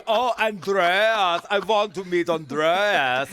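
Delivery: loud, high, stretched-out swooning cry; hammed-up excited impersonation; Michael McIntyre. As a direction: gush over the name, drawing it right out. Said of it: "And he was going".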